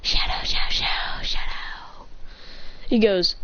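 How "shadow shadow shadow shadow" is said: unvoiced whispered creature chant; fading whispery creature talk; whispering creature. A narrator's reading. Pokemon speaks its name.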